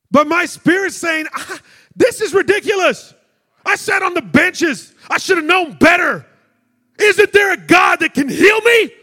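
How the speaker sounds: loud, high, building prayer cry; full-force preaching prayer. Acted. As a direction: plead with God out loud.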